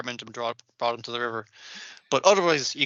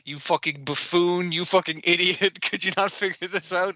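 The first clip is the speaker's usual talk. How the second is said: butler's tirade; 3 semitones higher than usual. Retold or imitated. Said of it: "as the butler points out".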